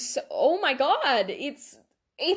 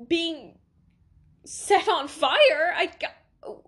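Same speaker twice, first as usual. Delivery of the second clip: real tearful voice, rising and breaking off; crying; 3 semitones higher, 4 dB louder, slower than usual. Real, not acted. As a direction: choke up until the words give out. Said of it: "I'm sorry for crying".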